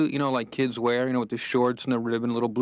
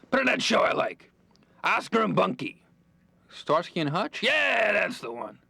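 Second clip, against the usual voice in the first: much higher, put-on old man voice; grandfather's voice; 9 semitones higher, slower than usual. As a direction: play the grandpa asking for his show. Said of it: "He's like".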